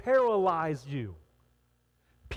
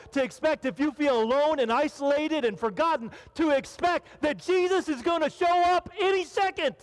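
rising preaching; 7 semitones higher than usual. Real, not acted. Preaching build to climax.

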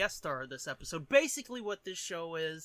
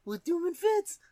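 high mock host voice; 10 semitones higher, 3 dB louder than usual. Mock radio host greeting.

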